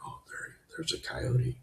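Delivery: whispered, hushed, just breath. Retold or imitated. Spotting a coyote on a dark street, whispered. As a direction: breathe it like you're afraid to scare it off.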